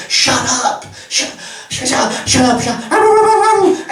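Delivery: high, agitated mock yelling; imitated neighbours shouting. Neighbours yelling at noisy foxes, mock shouting. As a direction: yell it high, in repeated bursts. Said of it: "because they're shouting".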